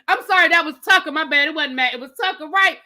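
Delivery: ordinary talk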